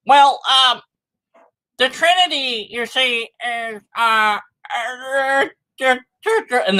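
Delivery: hammed-up apologist flustered by the Trinity; mock apologist. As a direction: stumble like an apologist out of answers. Apologist voiced, stumbling on Trinity.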